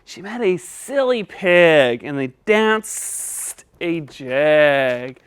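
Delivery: slow, deliberate reading; slow careful reading. Shown as a demonstration. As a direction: read it slowly and clearly. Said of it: "Try it again".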